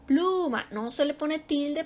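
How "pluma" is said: "Pluma" with PLU pitched high and held; stress on PLU. Shown as a demonstration. Teacher showing where the word is strongest, stress on PLU. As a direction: lift and stretch PLU in "Pluma". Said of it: "ahí está lo más fuerte".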